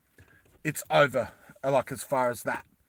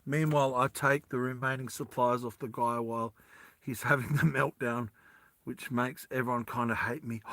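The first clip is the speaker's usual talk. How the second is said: ordinary talk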